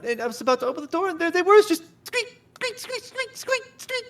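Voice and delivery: high voice dissolving into squeaky sobs; hammed-up crying, breaking on each squeak; falsetto sobbing. Farm woman breaking down while describing the rats, high and sobbing. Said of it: "breaks down sobbing".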